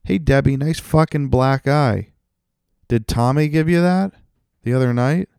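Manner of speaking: a rude party guest taunting Debbie, loud and high; mock tactless partygoer. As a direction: ham up a tactless guy at a party. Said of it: "Would I say that to".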